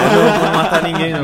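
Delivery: laughing reassurance; laughing while joking. Real, not acted. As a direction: joke it off with a laugh. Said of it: "Só tô brincando". (rindo, brincando)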